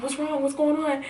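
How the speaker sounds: lightly voiced worried dad. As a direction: ask it like a startled parent. Dad hears her crying.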